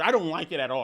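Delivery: ordinary talk, nothing marked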